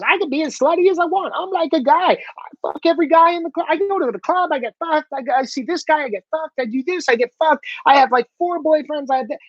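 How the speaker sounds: put-on high, bratty party-girl voice; mock party girl. Comic rant mocking a carefree club girl, in a high bratty voice. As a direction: ham up a boastful young woman. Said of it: "they thought the right thing to do was".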